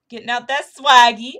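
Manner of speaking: loud hype shout on swaggy; hyped loud repeat. Acted. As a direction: belt out swaggy with a big rising swing. Repeats phrase, second louder.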